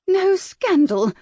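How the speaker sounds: high, loud, slow anguished moan on No scandal. A narrator's reading. Mother echoes the host's words in a high moan. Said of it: "Lady Brightwell moaned".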